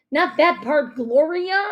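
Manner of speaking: loud, high, impatient whine; whining. A narrator's reading. Dad complaining that mom skips the best part, whining. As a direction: whine in protest. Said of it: "my dad whined".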